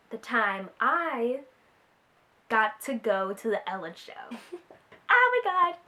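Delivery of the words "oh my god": excited squeal. Real, not acted. Overwhelmed saying it aloud.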